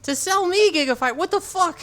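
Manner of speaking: hammed-up outrage at the installer; retold angry yell. Speaker yells back at installer, retold.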